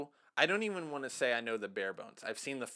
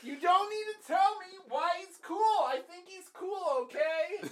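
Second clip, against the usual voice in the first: high-pitched comic protest; mock exasperation. Fan protesting an over-explained name, mock exasperated. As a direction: plead in a very high, indignant mock whine.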